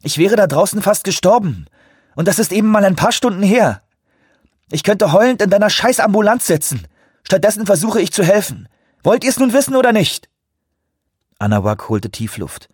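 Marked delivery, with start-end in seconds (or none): 0.0-10.3 laut, hoch, voller Empörung; wütend anfahrend. A narrator's reading. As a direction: sich in wachsende Wut hineinschreien. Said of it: "fuhr sie ihn an". (aufgebrachter Wutausbruch)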